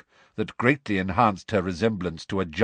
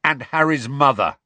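short, louder indignant snarl; 5 semitones higher, 5 dB louder than usual. A narrator's reading. George adding a second grievance, snarling. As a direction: add it with an angry push. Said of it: "snarled George".

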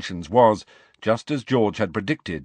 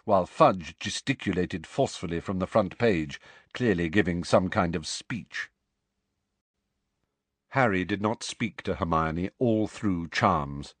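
plain reading voice

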